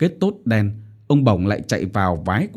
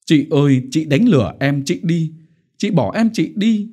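wailing lament; 6 semitones higher than usual. A narrator's reading. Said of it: "lại khóc".

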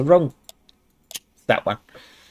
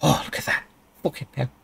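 hushed; 3 semitones lower, 8 dB quieter, faster than usual. Real, not acted. Awed at cooking result.

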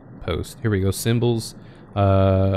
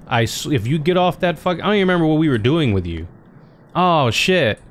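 ordinary delivery

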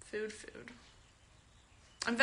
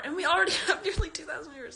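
laughing in disbelief; 6 semitones higher, 7 dB louder, faster than usual. Real, not acted. Amazed at viewer count on stream.

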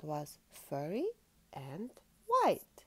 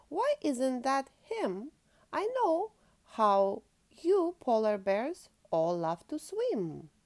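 loud, higher, swooping storybook voice; walrus character; 6 semitones higher, 8 dB louder than usual. A narrator's reading. Walrus advising the polar bear cub, loud and swooping. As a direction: call out helpfully as the walrus. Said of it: "wobbled Walrus".

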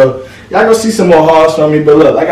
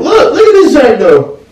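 excited; 10 semitones higher than usual. Real, not acted. Showing off a new outfit.

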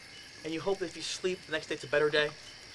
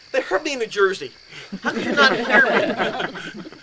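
higher, louder, slow accusing outburst; angry complaint; 7 semitones higher, 9 dB louder, slower than usual. Retold or imitated. Hurt man scolding his roommate, angry complaint. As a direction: let him have it, half laughing. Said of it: "I was, like, so angry".

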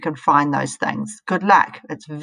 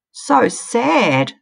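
higher squealed pity; 6 semitones higher, 4 dB louder than usual. Acted. Stink bug pitying the slug, high squeal. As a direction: squeal it out in dismay. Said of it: "squealed a stink bug".

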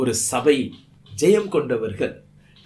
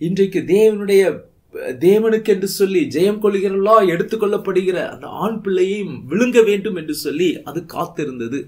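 rising preaching; 5 semitones higher, 4 dB louder than usual. Acted. Preacher builds up on serpent.

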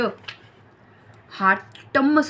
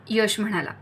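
ordinary delivery

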